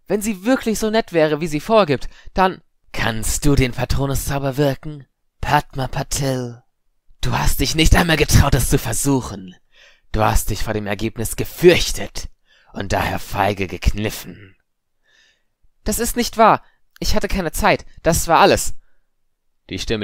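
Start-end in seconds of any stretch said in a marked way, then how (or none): 0.0-2.6 put-on high, loud indignant girl; upset girl, cut off mid-protest; agitated Padma. A narrator's reading. 2.9-14.6 loud, forceful accusation, slowing at the end; commanding voice exposing cowardice; stern ghostly challenger. A narrator's reading. Voice cuts in, challenges Padma. 15.9-18.8 put-on high, loud, upset girl; agitated girl making excuses; defensive protest. A narrator's reading. Padma defends herself against accusation.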